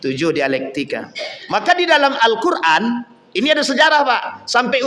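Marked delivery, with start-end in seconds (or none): 0.0-1.1 slow, low dawning realization; Umar bin Khattab. Acted. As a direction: draw it out low as acted understanding. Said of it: "Sehingga kata Umar bin Khattab". (baru paham, pelan dan rendah)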